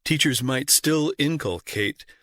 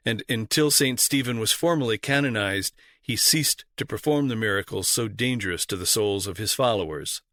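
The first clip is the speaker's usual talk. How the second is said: ordinary talk, nothing marked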